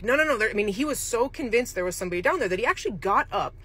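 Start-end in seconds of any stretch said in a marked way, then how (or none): 0.0-0.4 higher, louder insistent no; mock a stubborn old man insisting; retelling a resident who refuses to be told; insistent denial. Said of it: "And he's like".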